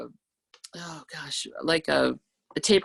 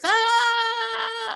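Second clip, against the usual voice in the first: high shrieking scream, full voice; sudden high screech to scare a baby; loudest yell; 4 dB louder than usual. Retold or imitated. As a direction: let out a piercing kid's scream. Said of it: "at our loudest voice".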